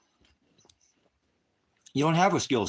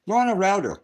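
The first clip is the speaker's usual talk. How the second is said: loud scorn; 10 semitones higher, 6 dB louder than usual. Real, not acted. Mocking trolls on livestream.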